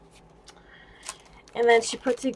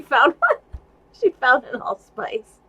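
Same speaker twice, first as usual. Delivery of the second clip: laughing through words; 5 semitones higher, 4 dB louder than usual. Real, not acted. Laughing over a found charm.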